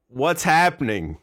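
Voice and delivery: usual speaking voice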